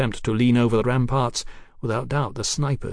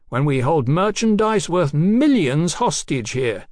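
high, projected sneer; taunting; 8 semitones higher, 3 dB louder than usual. A narrator's reading. Captain taunts the government over hostage goods, mocking. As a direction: jeer grandly at the authorities. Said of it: "Swing taunted".

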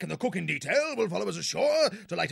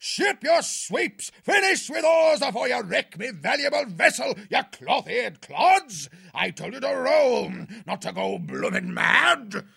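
berating the crew; 5 dB louder than usual. A narrator's reading. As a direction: bawl out the hapless rowers. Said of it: "Cuthbert started berating his hapless crew".

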